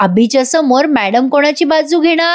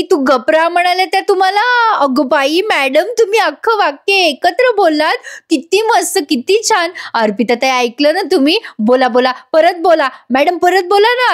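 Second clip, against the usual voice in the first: higher and gushing with joy; delighted; 6 semitones higher than usual. Retold or imitated. Gauri gushing to Madam and teasing Arpita, excited.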